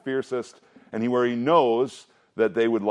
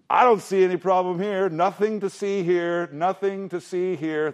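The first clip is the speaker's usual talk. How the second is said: mock innocent David; 8 semitones higher than usual. David tells the messenger there's nothing wrong, airy and innocent. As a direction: brush it off like nothing happened. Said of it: "What does David actually say".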